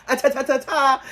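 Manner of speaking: high, hammed-up telling-off; mock scolding. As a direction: cut them off in a shrill mocking pitch. Acting out scolding interruption.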